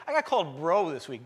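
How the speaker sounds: usual speaking voice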